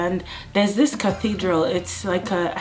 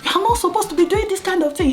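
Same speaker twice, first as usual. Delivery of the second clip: much higher, bossy cop lecture; imitated policeman scolding; scolding officer; 8 semitones higher than usual. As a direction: lecture them sternly.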